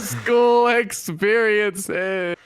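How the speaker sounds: slow loud grand reveal; dramatic announcement. Real, not acted. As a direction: draw out the reveal.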